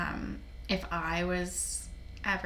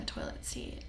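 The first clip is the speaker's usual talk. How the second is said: whisper; 10 dB quieter than usual. Real, not acted. Whispered end of line.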